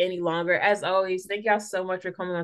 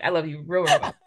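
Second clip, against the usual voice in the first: playful laughing repeat; 2 semitones lower, 4 dB louder than usual. Real, not acted. Host teasing her guest with affection, laughing. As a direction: tease it, laughing on the last words.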